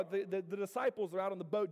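ordinary delivery

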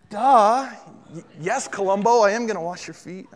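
hammed-up exasperation at an obvious question; mock sarcasm. Mocking answer to Peter's obvious question, sarcastic.

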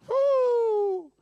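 high, loud held woo; long high-pitched cheer; admiring whoop. Real, not acted. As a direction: whoop in delight over his wife. Admiring his wife's beauty.

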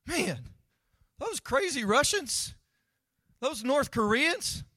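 high, loud, hammed-up fretting; mock panicked God. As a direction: play God as a frantic, clueless worrier. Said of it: "God's not up there in heaven saying".

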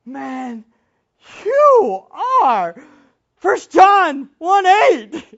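loud, high, slow rebuke; incredulous rebuke. Retold or imitated. Preacher rebuking the sinless preacher with scripture, incredulous. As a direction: call him out in disbelief. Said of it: "I was like".

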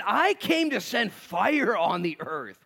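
loud, high and slow; dramatic scripture reading. Acted. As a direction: proclaim it like fire.

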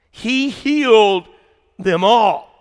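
slow, loud stress on "He healed them all"; emphatic proclamation. Real, not acted. Preacher drives home "He healed them all" to congregation, emphatic.

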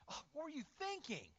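high-pitched exasperated scolding; mock berating. Berating someone who has fallen, mock scolding. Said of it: "He does not berate us".

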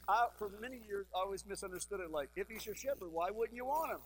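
ordinary talk, nothing marked

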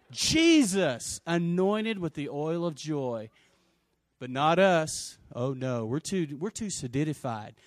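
ordinary delivery

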